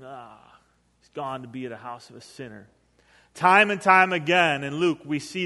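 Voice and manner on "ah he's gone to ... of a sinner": low, very soft grumble; muttering. Acted. Crowd complaining about Jesus visiting a sinner, muttering. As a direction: grumble it under your breath. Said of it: "they were just muttering".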